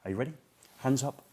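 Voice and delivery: ordinary talk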